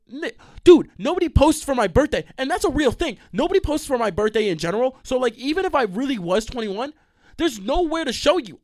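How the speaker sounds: high, loud exasperated rant; exasperated protest. Retold or imitated. Him protesting to the bouncer that nobody posts for his birthday. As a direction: blurt it out in frustration. Defending himself to bouncer, retold.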